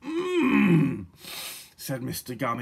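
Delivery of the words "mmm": loud, high "mmm" sliding downward; long, relishing sniff-and-hum; savouring "mmm". A narrator's reading. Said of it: "inhaling long and hard".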